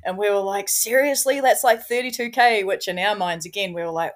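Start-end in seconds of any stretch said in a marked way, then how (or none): 0.7-2.6 higher and louder, mock astonishment; incredulous. Friends reacting to the German's plan, astonished higher and louder. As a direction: throw it back in disbelief.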